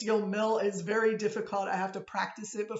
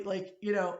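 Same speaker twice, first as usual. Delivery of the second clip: ordinary talk, nothing marked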